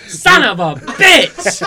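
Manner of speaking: loud, slow laughing curse; hammed-up cry through laughter; mock outburst. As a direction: blurt it out as a shocked joke. Acted instant reaction.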